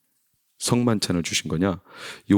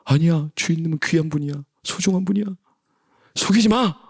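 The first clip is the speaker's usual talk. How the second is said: higher-pitched, pleading good side; Sméagol; 9 semitones higher than usual. Acted. Good side defending the master, pleading. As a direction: protest in a high, anxious creature voice. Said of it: "그런데 딱 바뀌어".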